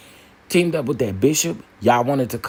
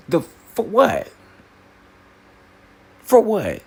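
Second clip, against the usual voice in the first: incredulous; 8 semitones higher, slower than usual. Real, not acted. Indignant retort to critics.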